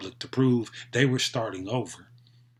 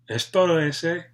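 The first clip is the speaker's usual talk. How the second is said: higher, louder slangy Chicano greeting; Chicano slang voice; 5 semitones higher, 4 dB louder than usual. Acted. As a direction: toss it off like a homeboy.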